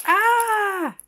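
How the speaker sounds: a high, loud delighted squeal; excited squeal. Real, not acted. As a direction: squeal with delight at something cute. Delighted at the cute scene.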